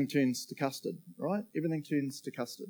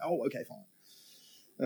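sheepish giving in; 4 dB quieter, much slower than usual. Retold or imitated. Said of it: "And I'm like".

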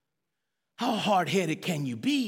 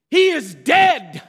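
1 declaring it; 5 semitones higher, 8 dB louder, much slower than usual. Acted. Preacher voicing Jesus, declares.

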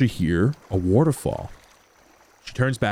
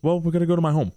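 higher, matter-of-fact guide; Kara, lightly voiced; 6 semitones higher, much faster than usual. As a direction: answer plainly as the guide. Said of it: "she goes".

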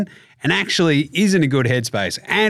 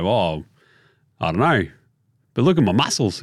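low, dim, lightly voiced mumble; dopey Simmons; 7 semitones lower than usual. Host mimics Simmons dodging shooting question, low dopey voice. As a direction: play him clueless.

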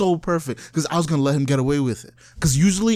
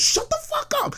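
high squealed mock yell; retold yell; 4 dB quieter than usual. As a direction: imitate her sudden high-pitched outburst. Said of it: "dead ass yelled".

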